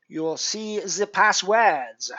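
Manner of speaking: slow, badly faked French Canadian accent; pathetic French Canadian accent. Streamer directing viewers to the passwords, awful French Canadian accent. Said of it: "my utterly pathetic attempt at a French Canadian".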